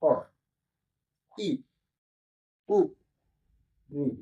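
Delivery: each vowel said short and clipped, one beat each; short vowels demo. Shown as a demonstration. Teacher showing students the short vowels, quick single-beat sounds. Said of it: "जैसे".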